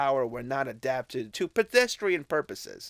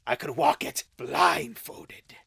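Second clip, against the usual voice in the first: fervent cry. Acted. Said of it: "cried Scrooge with fervor".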